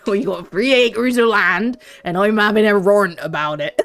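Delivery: put-on rustic West Country farmer accent; broad West Country burr. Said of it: "like farmer talk".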